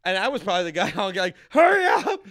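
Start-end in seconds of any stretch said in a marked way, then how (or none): 1.5-2.2 very high, loud desperate shout; frantic yelling. Retold or imitated. Driver hitting every light, frantic high yell. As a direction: scream it at slow drivers.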